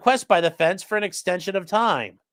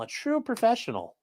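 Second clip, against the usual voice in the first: quiet sarcastic; 6 semitones higher, 6 dB quieter than usual. Acted.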